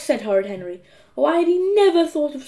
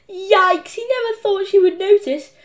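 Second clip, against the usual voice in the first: alarmed; 8 semitones higher, 5 dB louder than usual. A narrator's reading. Henry's alarmed thought in narration.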